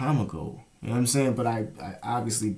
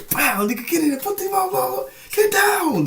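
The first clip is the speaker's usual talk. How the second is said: very high, shouted mock cop commands; imitating raiding cops; 6 dB louder than usual. Mimicking jump-out boys busting in, high mock yell. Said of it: "they say".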